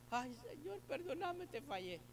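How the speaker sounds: high, pleading sinner's prayer; mock tearful prayer. Preacher voices a girl begging God's forgiveness, mock tearful. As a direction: plead like a repentant girl, half in jest.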